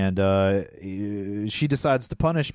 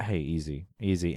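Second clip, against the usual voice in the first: soft soothing; 8 semitones lower, 7 dB quieter than usual. Retold or imitated. Calming an upset Maverick.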